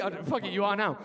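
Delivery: normal talking voice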